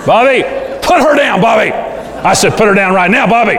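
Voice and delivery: high-pitched, frantic scolding; parent losing control. Acted. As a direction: act out an out-of-control parent giving orders. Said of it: "parents lose control".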